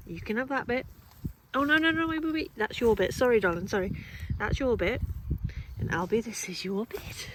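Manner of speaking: high, loud, flustered calls to the dogs; to her dogs over the birthday cake, flustered and loud; excited dog-owner calls. Real, not acted. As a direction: hold off an eager dog while sharing out treats. Dividing cake between two dogs.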